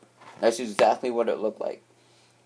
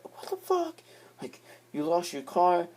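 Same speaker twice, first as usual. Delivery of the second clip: high, shrill mock outraged parent; angry parent; 8 semitones higher, 3 dB quieter than usual. Typical parent hearing the car is lost, mock outrage. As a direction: squeal it like a shocked parent.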